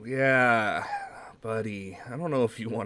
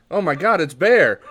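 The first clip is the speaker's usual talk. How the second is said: loud, high delighted cry; overjoyed to see an old friend; thrilled; 8 semitones higher, 11 dB louder than usual. Acted. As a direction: shout with sudden delight. Player thrilled at the visitor.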